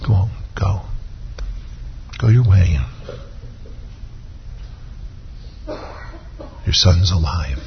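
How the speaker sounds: soft, slow and gentle, almost hushed; Jesus gently sends the father home, softly; tender reassurance. Retold or imitated. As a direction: comfort a weeping father, slowly. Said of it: "Jesus looked in his eyes and said".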